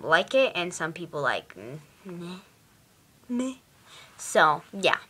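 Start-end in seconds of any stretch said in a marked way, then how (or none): 1.6-3.5 imitated indifference. Vlogger imitating readers unsure about a book, unimpressed. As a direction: shrug it off like a lukewarm reader. Voicing readers who disliked it.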